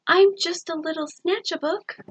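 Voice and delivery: high, small and sorry creature voice; mournful mumble. A narrator's reading. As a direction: say it head hung, a touch mournful. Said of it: "he mumbled with a mournful look".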